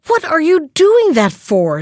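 high-pitched indignant question; storybook indignation, pitched well up. A narrator's reading. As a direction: demand an explanation, affronted. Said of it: "she asked indignantly".

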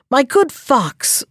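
slow, firm and resolute; determined voice. Acted. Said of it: "a determined voice".

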